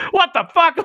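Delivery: high-pitched mock outburst of outrage; mock furious. Storyteller imitates the counselor finding two campers, mock fury. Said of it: "He was furious".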